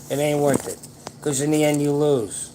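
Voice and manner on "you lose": normal talking voice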